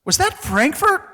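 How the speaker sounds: very high imitated child voice; questioning kid. Kid checking a memory with dad, high questioning voice. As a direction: pipe up high, unsure like a kid. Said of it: "when my kids talk about it".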